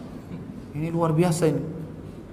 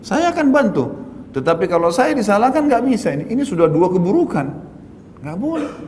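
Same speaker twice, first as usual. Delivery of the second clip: emphatic retold stand; 7 semitones higher than usual. Preacher retells his own firm stand.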